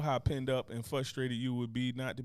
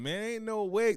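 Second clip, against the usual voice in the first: ordinary talk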